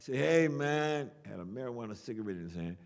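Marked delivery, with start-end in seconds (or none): 0.2-1.1 loud, higher imitation of a stranger hailing someone; calling out. Big guy with a chair hails the poet, calling out. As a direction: holler to get his attention.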